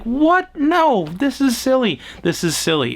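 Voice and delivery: can't believe what the villain is doing; incredulous. Retold or imitated. Viewer watching the villain monologue, high incredulous protest.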